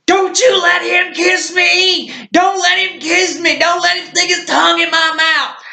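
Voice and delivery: very high mock-terrified yell; put-on high-pitched frantic protest; imitated panicked drunk. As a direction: holler it high in comic panic. Imitates drunkard panicking about a kiss.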